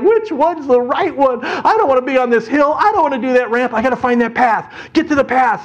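high, frantic wail while hurtling downhill; panicked rider. Acted. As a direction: cry it out high in hammed-up panic. Henry panicking, bouncing down the hill.

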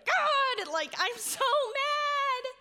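high, loud, slow mock scream to the sky; a loud, stretched-out pretend yell of frustration. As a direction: howl it out like screaming outside. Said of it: "go outside and just scream and be like".

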